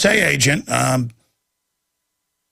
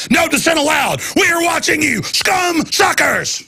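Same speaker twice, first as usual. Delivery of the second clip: hammed-up shouted threat; mock shouted quote; 12 semitones higher than usual. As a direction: bellow it like a tyrant's threat. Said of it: "They actually say".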